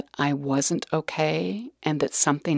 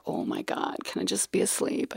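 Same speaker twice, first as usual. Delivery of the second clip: hushed despair; 5 semitones higher, 7 dB quieter than usual. Retold or imitated. Desperate inner thought retold.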